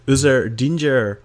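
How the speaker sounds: normal talking voice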